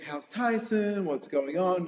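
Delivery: lightly sung-out friendly greeting; imitating in-laws. In-laws warmly asking about her, light imitation. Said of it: "they're always like".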